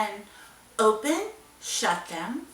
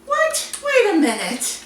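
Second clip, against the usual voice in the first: high squeaky what; mock shock; 9 semitones higher than usual. Teacher pretends shock at George's meow, high squeak. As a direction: act baffled and amazed.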